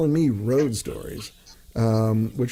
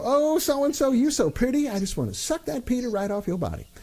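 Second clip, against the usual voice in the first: campy flirt pitched way up high; high, playful camp impression; 11 semitones higher than usual. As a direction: flirt outrageously in a high teasing voice. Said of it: "[name] turned around and go".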